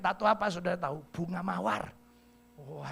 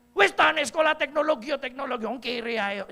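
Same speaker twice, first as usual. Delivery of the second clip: brash auntie; 5 semitones higher, 7 dB louder than usual. Retold or imitated. Auntie brushing off the pastor's correction, loud and brash.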